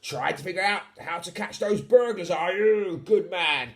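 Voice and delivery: ordinary delivery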